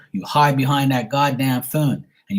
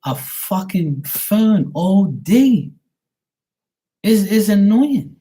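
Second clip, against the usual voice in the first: high, slow, drawn-out exasperation; annoyed outburst; 8 semitones higher, 4 dB louder, much slower than usual. Real, not acted. Ranting about women hiding behind phones all day, drawn-out annoyance. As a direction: stretch each word out in rising annoyance.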